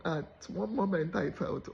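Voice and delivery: usual speaking voice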